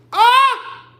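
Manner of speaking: sudden, loud high-pitched wail; high crying yelp. Real, not acted. Woman overcome by a sad story, high crying yelp. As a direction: let out a sharp cry. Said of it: "I'm about to cry".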